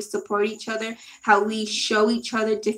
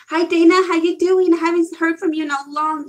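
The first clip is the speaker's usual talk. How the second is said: high loud delighted hello; excited greeting; 6 semitones higher, 6 dB louder than usual. Real, not acted. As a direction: call out happily to a friend. Excitedly greeting old friend.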